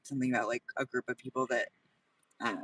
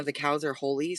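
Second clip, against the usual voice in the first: ordinary talk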